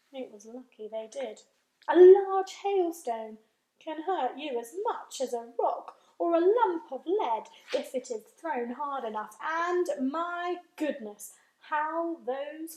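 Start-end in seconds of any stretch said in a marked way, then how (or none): none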